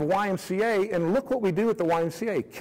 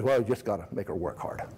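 low, curt coach voice; 9 semitones lower, 4 dB quieter than usual. Retold or imitated. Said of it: "He said".

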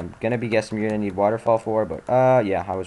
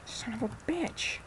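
high strained curse; 13 semitones higher, 13 dB quieter, slower than usual. Real, not acted. Gamer annoyed by item.

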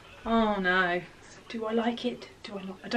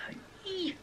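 whisper; 10 semitones higher, 10 dB quieter than usual. Real, not acted. Unsure about a top.

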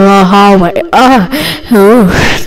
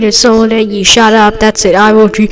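ordinary talk

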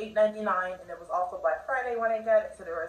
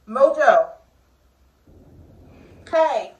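calling to a pet; 4 semitones higher, 11 dB louder, slower than usual. Real, not acted. Calls out her meddling cat.

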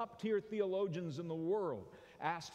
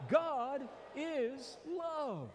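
higher, very slow, fervent; reminding the neighbor; 9 semitones higher, much slower than usual. Shown as a demonstration. Preacher modelling a reminder to the other neighbor, fervent. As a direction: stretch it out like a proclamation. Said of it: "remind them".

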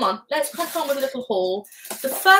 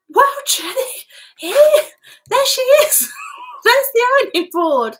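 high, loud squeal through laughter; real hysterical laughing shout; laughing outburst; 14 semitones higher, 10 dB louder than usual. Real, not acted. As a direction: shriek it, cracking up at being teased. Teased about ironing board, shrieks laughing.

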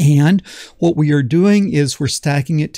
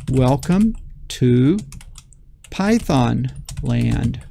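drawn out, typing as he speaks; slow dictation; much slower than usual. Shown as a demonstration. Teacher typing the greeting, slow dictation. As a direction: read the words out slowly while typing. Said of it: "and then I'm going to say".

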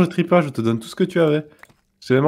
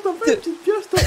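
mocking imitation; 11 semitones higher, 4 dB quieter than usual. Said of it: "quand il a dit".

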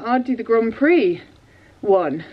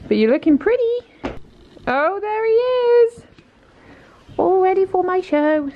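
pet talk; 8 semitones higher than usual. Real, not acted. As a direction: coo a greeting to the horse. Greeting horse, voicing him.

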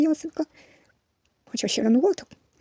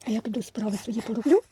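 ordinary talk

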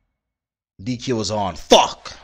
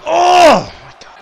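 surprised; 12 semitones higher, 5 dB louder than usual. Real, not acted. Sudden reversal in game.

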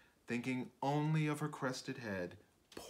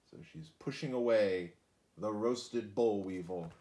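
usual speaking voice

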